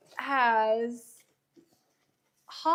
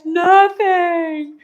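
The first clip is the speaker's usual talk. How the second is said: loud, high, drawn-out holler; mock wail; 5 semitones higher, 11 dB louder than usual. Friend mimics the fallen rider refusing to be touched, wailing. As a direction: imitate a hurt friend crying out in protest.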